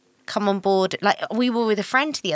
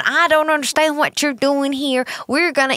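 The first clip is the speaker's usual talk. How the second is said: higher-pitched Texan twang; Texan accent; 5 semitones higher than usual. Shown as a demonstration. Partner insisting they can do it, Texan accent. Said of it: "with my Texan accent".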